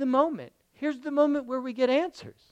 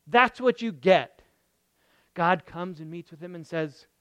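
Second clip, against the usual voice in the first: normal talking voice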